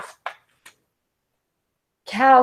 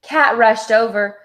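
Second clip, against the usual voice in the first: plain reading voice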